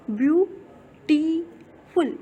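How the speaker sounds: Beautiful slowly split as view, टी, फुल; syllable by syllable. Shown as a demonstration. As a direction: break beautiful into three drawn-out syllables with clear pauses. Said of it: "धीरे से बोल करके". (Beautiful को धीरे, तीन हिस्सों में रुक-रुक कर)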